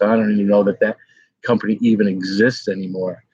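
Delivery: ordinary talk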